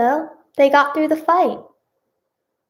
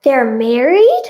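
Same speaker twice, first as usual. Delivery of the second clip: slow, louder question, rising sharply; surprised question; 7 dB louder, slower than usual. Real, not acted. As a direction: draw it out in disbelief. Reader reacts to plot twist.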